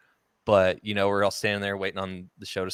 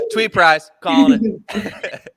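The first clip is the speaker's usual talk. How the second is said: laughing; 11 semitones higher, 9 dB louder, much slower than usual. Real, not acted. Joking about calling a song.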